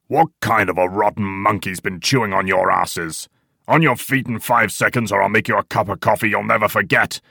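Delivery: loud, harsh sergeant's threat; angry tirade. A narrator's reading. Old man threatens the coffee drinkers, angry bawling. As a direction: bawl them out without raising pitch.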